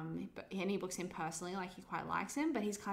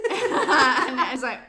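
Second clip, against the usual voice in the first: laughing loudly through the words, slowed; can't get it out for laughing; 6 semitones higher, 17 dB louder, much slower than usual. Real, not acted. Bursting into laughter mid-story.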